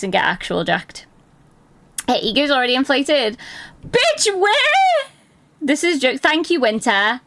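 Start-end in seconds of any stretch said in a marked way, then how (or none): 3.9-5.1 high mock outrage. Real, not acted. Denying her ego is inflated.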